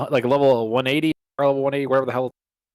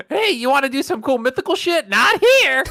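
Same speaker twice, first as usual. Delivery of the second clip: much higher, put-on taunting voice; game voiced as a high mocking character; mocking Valhalla voice; 11 semitones higher, 4 dB louder than usual. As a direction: voice the game as a smug troll. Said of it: "voicing the game mockingly".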